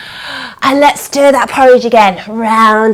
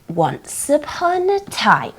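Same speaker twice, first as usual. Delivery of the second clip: soft, slow, spaced-out words; slow, quiet story-time chant; storybook opening; 2 semitones higher, 9 dB quieter, slower than usual. Pretend. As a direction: open the book with hushed ceremony. Ritual opening of the story book.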